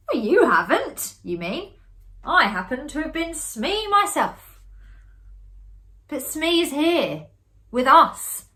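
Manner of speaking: loud, high protest; cried out. A narrator's reading. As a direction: insist loudly. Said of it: "I cried".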